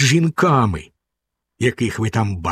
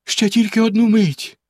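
pleading; 8 semitones higher than usual. A narrator's reading. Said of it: "благально мовила дружина".

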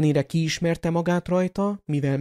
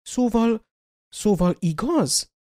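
halting, slower repeated szóval; stammering; 6 semitones higher than usual. Acted. McGonagall hardly daring to ask, stammering. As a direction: stumble over the words.

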